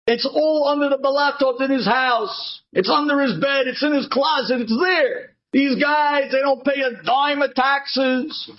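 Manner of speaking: high, eager snitch on the phone; gleeful tattletale phoning it in; sneaky informer. Acted. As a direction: rat him out to the taxman, keen and pushy. Informer calling the tax office.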